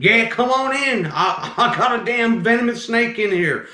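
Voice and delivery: high, worked-up southern man; southern accent. Retold or imitated. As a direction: imitate an excited southern customer. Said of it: "he's talking with his southern accent".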